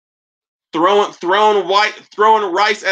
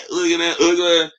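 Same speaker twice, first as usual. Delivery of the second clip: ordinary delivery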